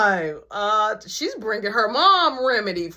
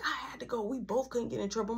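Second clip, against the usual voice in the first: whisper; 10 dB quieter, faster than usual. Retold or imitated. Whispering friends hiding from mom.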